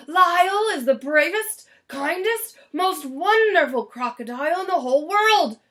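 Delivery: loud, effusive public praise; grateful Mister Grumps. Acted. Mister Grumps praising Lyle to the crowd, loud and effusive. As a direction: proclaim his gratitude to everyone.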